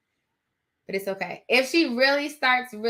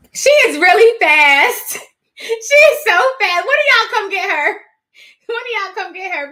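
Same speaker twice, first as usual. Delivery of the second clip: exasperated outburst; 8 semitones higher, 11 dB louder than usual. Real, not acted. Frazzled owner complaining about her wild puppy, loud and high. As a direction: burst out in mock despair.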